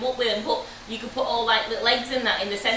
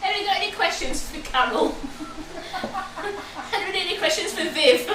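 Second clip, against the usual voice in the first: laughing; 7 semitones higher than usual. Real, not acted. Laughing asking viewers.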